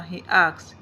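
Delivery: ordinary delivery, nothing marked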